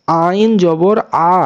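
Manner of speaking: long, slow আ from a tight throat; আ held as a pharyngeal ain sound; throat-squeezed ain. Shown as a demonstration. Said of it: "একটু গলা চিপে".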